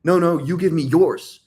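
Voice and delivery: mock insistent objection; weirdly insistent. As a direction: overdo the comeback like a man who must win. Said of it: "A, a weird thing there would be like".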